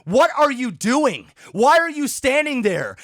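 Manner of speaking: ordinary delivery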